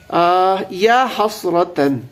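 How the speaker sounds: یا حسرتن intoned very slowly, stretching یا; slow Quran-style intoning; Quranic recitation. Shown as a demonstration. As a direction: recite یا حسرتن word by word like a verse. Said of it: "تو یا حرف ندا ہے۔".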